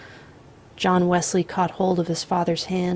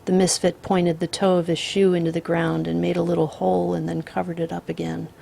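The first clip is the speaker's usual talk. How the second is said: usual speaking voice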